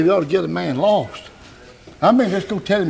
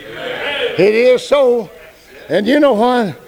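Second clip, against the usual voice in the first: raised, loud preaching with stretched endings; forceful preaching; 7 semitones higher, 4 dB louder than usual. Acted. Preacher marvels at Jesus here and above, loud forceful preaching. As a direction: drive it out to the congregation, drawing out the last words. Preaching swells before congregation.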